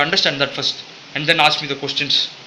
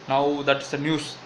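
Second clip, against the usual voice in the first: normal talking voice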